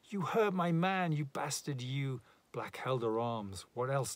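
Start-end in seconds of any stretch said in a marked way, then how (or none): 0.0-2.2 high, loud furious cry fading out; a woman screaming at the man who hurt hers; sobbing and swearing. A narrator's reading. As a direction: scream at him through sobs. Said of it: "she sobbed, she swore".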